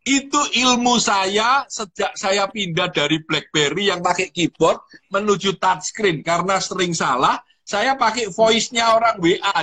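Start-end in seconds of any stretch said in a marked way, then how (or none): none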